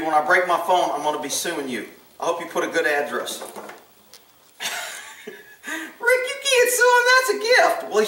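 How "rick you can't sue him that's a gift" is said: high-pitched nagging imitation; mock scolding viewer. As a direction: mimic an objecting viewer in falsetto. Voices a scolding viewer objecting.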